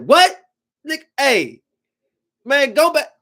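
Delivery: high, loud disbelieving "what, go back"; incredulous scolding. Retold or imitated. Telling the kid to cut out the tantrum, incredulous and high.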